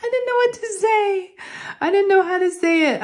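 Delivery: high-pitched, squealing mock distress; embarrassed wail. Telling viewers about an awkward vet question, embarrassed high wail. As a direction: wail it high, mortified in retelling.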